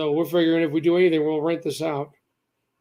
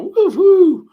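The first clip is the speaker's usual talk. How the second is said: high, loud cheer; excited whoop; 14 semitones higher, 10 dB louder than usual. Real, not acted. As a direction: whoop for joy, much higher. Celebrates four viewers watching.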